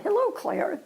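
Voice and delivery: high, sing-song hello; a man's high, friendly hello; cheery greeting. Retold or imitated. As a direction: greet her like an old friend. Man greeting her warmly.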